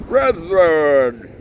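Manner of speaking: loud, high call to a gathering; shouting. A narrator's reading. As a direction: call the crowd to order at full voice. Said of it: "he shouted".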